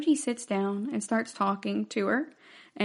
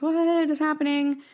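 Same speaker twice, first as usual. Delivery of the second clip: higher, quick mock-baffled question; imitated bewilderment; 7 semitones higher, faster than usual. Reviewer voices Soji reacting to Rhonda, imitated bewilderment. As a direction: blurt it up high, acting startled. Retold startled reply to odd claim.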